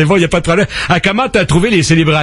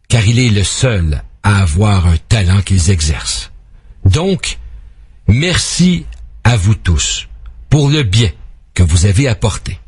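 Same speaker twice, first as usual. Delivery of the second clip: measured ode delivery with dramatic pauses; formal, phrase-by-phrase poetic reading; solemn poem recitation; 4 semitones lower than usual. Acted. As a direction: declaim a tribute poem to hockey heroes. Reads a tribute poem.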